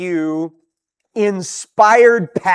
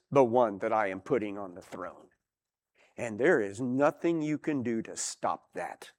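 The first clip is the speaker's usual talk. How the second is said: low, much softer, quietly certain; God answering; 6 semitones lower, 8 dB quieter than usual. Acted. God in the heavens answering rebel rulers, low and quiet. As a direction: say it like God calmly overruling them. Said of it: "And God in the heavens goes".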